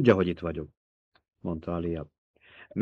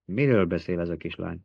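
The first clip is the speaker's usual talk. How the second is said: usual speaking voice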